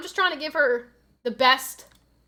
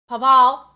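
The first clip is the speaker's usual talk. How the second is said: Pavel tried loudly with a heavier second syllable; alternative pronunciation; 11 dB louder than usual. Shown as a demonstration. Streamer testing a viewer's name, loud second syllable on Pavel. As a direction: try out Pavel as pa-VEL, much louder. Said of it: "Should it be like Pavel?".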